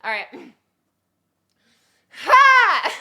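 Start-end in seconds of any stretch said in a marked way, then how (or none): 2.1-2.9 sharp, much higher, much louder shout; battle cry attempt. Shown as a demonstration. Streamer testing a battle cry voice line, short loud shout. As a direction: bark it out like a war cry. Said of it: "It just sounds, it sounds too, like, kiddish".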